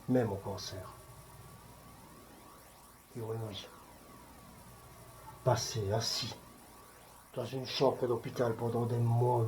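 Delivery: hushed, low-pitched sorrow; douleur contenue. Acted. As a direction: speak softly and low, holding the grief in. Acted evocation of losing a loved one. (voix basse, chagrin contenu)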